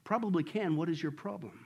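low, soft, unhurried heavenly reply; calm voice. Acted. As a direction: answer placidly from above. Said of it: "the calm voice said".

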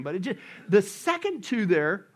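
normal talking voice

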